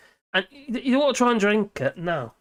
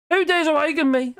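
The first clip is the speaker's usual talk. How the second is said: booming genie demanding who woke him; 9 semitones higher than usual. Acted. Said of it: "I get you".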